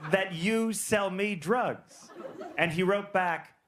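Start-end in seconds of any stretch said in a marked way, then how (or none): 0.1-2.1 high, drawn-out wonder; mock bewildered inquiry; puzzled slow question. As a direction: ask it slowly in disbelief. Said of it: "I go".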